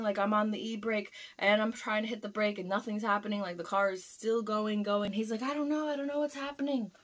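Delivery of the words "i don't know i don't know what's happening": high-pitched, mildly panicked retelling; imitated panic. Coworker answers driver as truck gets pushed, imitated panic.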